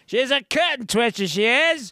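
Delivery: high, hammed-up English accent; March Hare. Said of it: "Now I got it".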